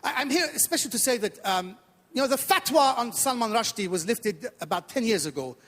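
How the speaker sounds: put-on comic Iranian accent, eager to please. Comedian playing Iranian immigrant to the audience, put-on Iranian accent. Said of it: "a bit of an accent I do at the beginning".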